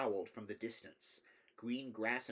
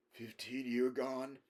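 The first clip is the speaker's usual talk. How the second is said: put-on slow, rambling old countryman; rambling old man; 3 semitones higher, slower than usual. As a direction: drift off like a wandering old villager. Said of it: "he rambled".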